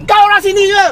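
angry. Real, not acted. Driver scolding rider.

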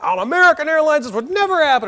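high, loud furious rant; angry screaming customer. Retold or imitated. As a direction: chew her out at full volume. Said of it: "he's screaming and he's yelling".

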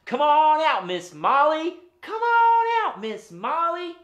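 high, loud drawn-out call; loud singsong holler for a hidden friend; big calling voice. Real, not acted. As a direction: summon someone from far away, stretching the words. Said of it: "call her out in a big voice".

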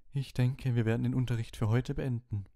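high, airy seer's voice; misty voice. A narrator's reading. Trelawney to the class, ending the lesson in her mistiest voice. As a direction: end the lesson in a dreamy mystic tone. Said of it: "mit ihrer rauschigsten Stimme".

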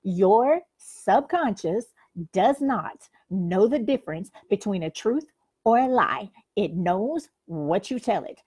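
slow, weighty, straight to camera; repeating the key point slowly to the viewer; emphatic repeat. Shown as a demonstration. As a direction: lean in and stress every word for them. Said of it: "let me repeat this. Pay attention".

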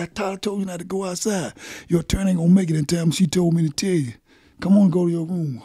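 scolding officer. Retold or imitated. Officer scolding him in story.

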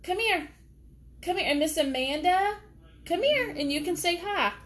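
high coaxing voice to the dog; pet talk. Real, not acted. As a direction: sweet-talk the dog over to the camera. Calling the dogs over.

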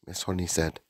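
plain reading voice

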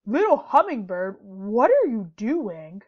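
loud, slow bear voice calling out; a big bear asking the bird in wonder; lightly voiced bear, booming and slow. A narrator's reading. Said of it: "Big Bear said".

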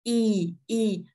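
Y as a long, clear ee; long ee vowel. Shown as a demonstration. Teacher shows learners the long vowel, clearly drawn ee. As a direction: hold each Y as a full ee. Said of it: "có dấu hai chấm làm y dài".

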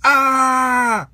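high, loud scared shout; frightened yell. A narrator's reading. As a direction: yelp in sudden fright. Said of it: "yelled Buzz".

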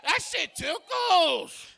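put-on loud, startled yelp at the baby; mock shout. Grandpa yelling at the baby latched onto him, loud and startled. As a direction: yell out in shock, then slow it down. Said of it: "I said".

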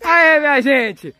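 high, excited wrap-up; jubilant announcement. Pretend. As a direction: announce the happy ending in a high, celebratory voice. Celebrates the completed story. (anúncio festivo, voz aguda e animada)

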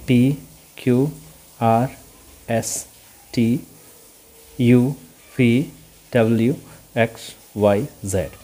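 spaced-out alphabet drill; letter-by-letter recitation. Shown as a demonstration. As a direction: recite the alphabet slowly, a pause after each letter. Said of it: "आप ऐसे ही पढ़ते हैं". (एक-एक अक्षर रुक-रुककर पढ़ते हुए)

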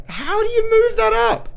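very high-pitched outburst; exasperated squawk. Real, not acted. Player struggling with the controls, high exasperated yell. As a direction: squawk the question in frustration.

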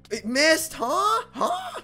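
high cracking squeal of disbelief; disbelieving squeal. Real, not acted. Attack misses, cries out.

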